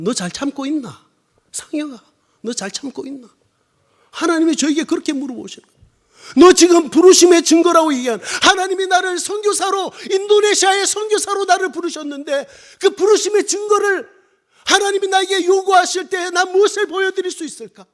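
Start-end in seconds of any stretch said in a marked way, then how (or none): 0.0-3.3 Busan dialect question ending in 있나; Gyeongsang dialect. Shown as a demonstration. Preacher shows how God asked Sanghyeok, in Gyeongsang dialect. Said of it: "경상도 사투리로 하면". 6.4-17.8 high, pressing pulpit cry; full-force preaching. Real, not acted. Missionary asks what proof he can show God, full-force preaching.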